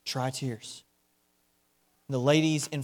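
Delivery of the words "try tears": soft, low, solemn words; hushed telegram. Retold or imitated. William Booth's reply to the ladies, low and hushed. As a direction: say it quietly and gravely. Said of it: "He says".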